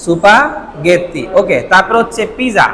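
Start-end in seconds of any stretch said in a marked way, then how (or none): 0.0-1.2 সুপাগেত্তি stretched very slowly, mora by mora; slow katakana reading. Shown as a demonstration. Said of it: "শব্দটা কি?".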